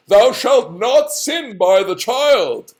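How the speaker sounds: lofty. Acted. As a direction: intone it like a commandment. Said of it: "he's using very lofty language".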